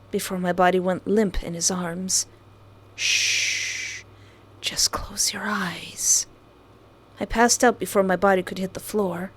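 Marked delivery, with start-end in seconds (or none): 2.9-6.3 slow, breathy villain whisper; creepy whisper. Acted. Kidnapper soothing his victim, slow sinister whisper. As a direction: hush her to sleep menacingly.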